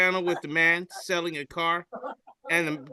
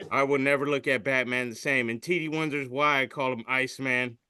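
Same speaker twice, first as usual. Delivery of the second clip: ordinary talk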